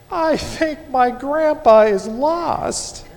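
put-on high, slow child's voice, trying to be brave; brave little girl. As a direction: imitate a six-year-old bravely holding back tears. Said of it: "as bravely as she can".

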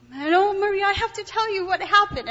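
high, loud eager rush; excited. Retold or imitated. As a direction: burst out with the news. Excited call to Maria.

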